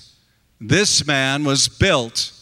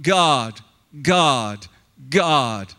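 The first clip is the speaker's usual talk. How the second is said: broken repeated God; 4 semitones lower, much slower than usual. Retold or imitated. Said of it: "he's probably only saying".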